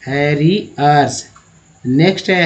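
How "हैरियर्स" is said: "हैरियर्स" drawn out slowly, syllable by syllable; teacher slowly repeating "हैरियर्स" for learners. Shown as a demonstration.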